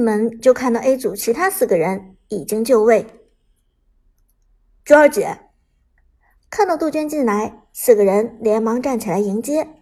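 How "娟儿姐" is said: louder, respectful call; greeting call. A narrator's reading. Team members greeting their coach Juan, called out louder.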